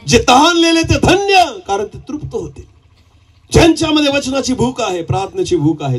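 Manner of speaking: high-pitched preacher's proclamation, easing downward; fervent preaching. Acted. Preacher to congregation on blessed thirst, fervent high-pitched preaching. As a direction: proclaim it high, then let it fall away.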